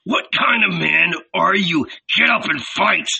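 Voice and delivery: high, forceful growled shout; exasperated, contemptuous outburst; furious goading. A narrator's reading. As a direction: roar a challenge to make them fight. Blacksmith goads victim to fight.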